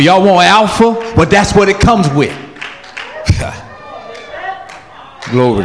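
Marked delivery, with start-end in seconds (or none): none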